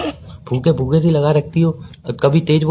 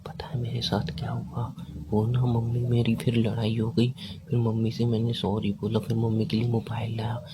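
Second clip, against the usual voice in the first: mock whisper; 3 semitones lower, 11 dB quieter than usual. Shown as a demonstration. Boyfriend mimics his girlfriend's whispering on the late call, mock whisper. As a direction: hush it right into her ear to show her how it feels. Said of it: "जब कोई इंसान धीरे धीरे बोले, फूके फूके".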